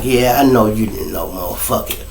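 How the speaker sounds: low soft menacing retort; understated threat; cold quiet reply. Retold or imitated. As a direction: say it flat and cold.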